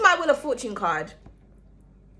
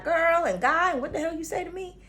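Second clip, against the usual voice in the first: a hint of a Southern accent, half-joking; mock Southern accent; 4 semitones higher, 3 dB quieter than usual. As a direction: tease out a put-on Southern American accent. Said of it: "that's where they have the sexy accents".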